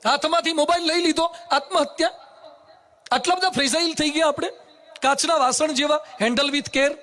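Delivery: high, ringing sermon scolding the stubborn; preaching at full force. Acted. (ઊંચા સ્વરે જોરદાર પ્રવચન)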